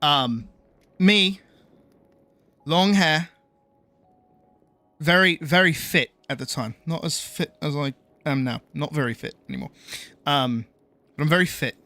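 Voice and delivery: measured English accent. Shown as a demonstration. Streamer showing viewers his old voice, English accent. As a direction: slip back into a native-sounding English accent. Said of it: "talk like this ... what I used to sound like".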